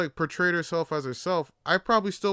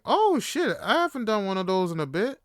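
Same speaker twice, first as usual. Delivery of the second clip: excited yes; 5 semitones higher, 3 dB louder than usual. Retold or imitated. Excitedly accepts double date invite.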